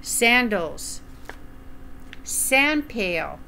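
plain reading voice

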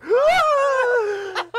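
very high, loud shriek into laughter. Retold or imitated. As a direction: scream at the second squirrel, then crack up. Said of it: "I'm like".

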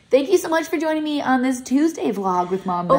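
high, sing-song outro voice; hammed-up vlog sign-off. Host thanks viewers for joining her vlog, playful high sing-song.

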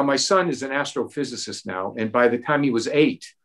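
ordinary delivery

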